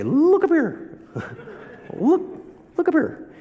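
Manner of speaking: very high squeaky falsetto call; Three Amigos quote. Retold or imitated. Boy warning his classmate in a silly high squeak. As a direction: squeal it up high like a movie bit. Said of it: "Or in the Three Amigos say".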